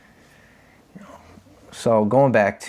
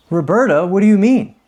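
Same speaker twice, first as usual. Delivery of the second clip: mock protest; 7 semitones higher, 5 dB louder than usual. Retold protest to probation officer.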